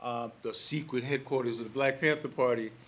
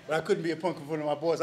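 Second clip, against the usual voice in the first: normal talking voice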